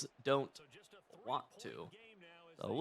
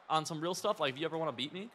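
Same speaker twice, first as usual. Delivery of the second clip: ordinary delivery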